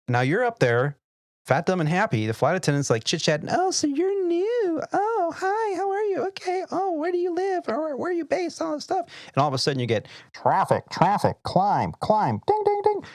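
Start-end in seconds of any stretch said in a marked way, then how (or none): 3.5-9.1 mock flight attendant chit-chat in a much higher voice; chatty flight attendant. 10.3-13.0 mock traffic alert, rising higher and louder with each call; imitated collision alert climbing in pitch; cockpit alarm.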